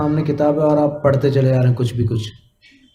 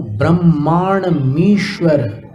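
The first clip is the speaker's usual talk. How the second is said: मनंत Manang केतुम split slowly, syllable by syllable; slow syllable demo; much slower than usual. Shown as a demonstration. Teacher breaking the phrase into slow chunks for students. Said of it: "ऐसा बोलना है".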